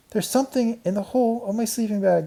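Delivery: put-on high excited girl voice. A narrator's reading. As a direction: cry out in discovery. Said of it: "cried Karen".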